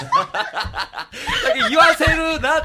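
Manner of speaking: high loud laughter; laughing. Real, not acted. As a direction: burst out laughing. Laughing protest.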